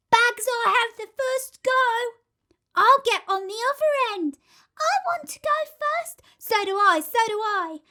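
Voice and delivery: put-on high, loud eager kid voices; excited children shouting. A narrator's reading. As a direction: squabble for the first go. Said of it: "shouting with excitement".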